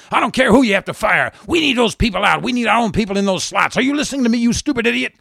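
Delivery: high, loud screamed orders; screaming. Acted. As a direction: scream at him, peaking on the question. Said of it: "she's screaming".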